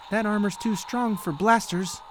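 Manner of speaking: put-on a young hero calls it over the radio; Luke declaring. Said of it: "Luke declared".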